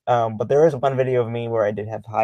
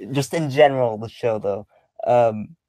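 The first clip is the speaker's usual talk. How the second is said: normal talking voice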